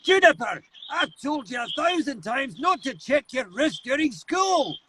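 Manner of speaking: high, strained curmudgeon's scolding; curmudgeonly scold. Acted. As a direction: scold like an exasperated old curmudgeon. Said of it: "he was this curmudgeon-y".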